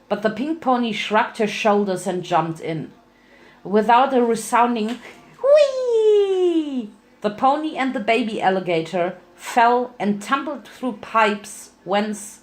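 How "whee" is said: high, loud gleeful squeal; excited whee. Acted. Pony jumping into the dark pipe, high gleeful squeal. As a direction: squeal with delight as you drop.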